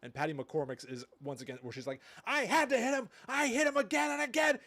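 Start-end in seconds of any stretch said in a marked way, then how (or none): 2.2-4.6 loud, high-pitched imitated hysterical confession; hammed-up frantic movie outburst; scary child's confession. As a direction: shriek it like a child killer confessing in a film. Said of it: "And it's so scary".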